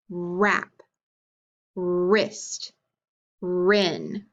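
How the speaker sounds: long held R opening "Wrap", "wrist" and "wren"; "Wrap", "wrist", "wren" each begun on a drawn-out R; stressed initial sound. Shown as a demonstration. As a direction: stretch the first R of "Wrap", "wrist", "wren", with silent W. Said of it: "Try 'em with me".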